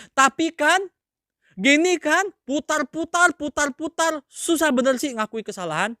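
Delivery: high, whiny mock excuses going in circles; mock the dodging excuse; whiny excuse-maker. Preacher mocking a member who won't admit wrong, whiny and high.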